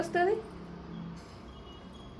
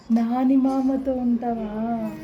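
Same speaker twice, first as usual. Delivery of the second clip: loud, slow question; raised voice, stretched-out words; speaking up; 5 dB louder, much slower than usual. Shown as a demonstration. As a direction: draw it out loudly for the child. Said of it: "గట్టిగా చెప్పాలి".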